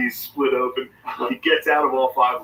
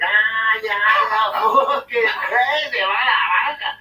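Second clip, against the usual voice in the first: higher, louder drunken whoop in a put-on voice; rowdy Irish shout; 10 semitones higher, 3 dB louder, much slower than usual. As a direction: burst in yelling like a wild drunk. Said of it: "He walks in, he's like".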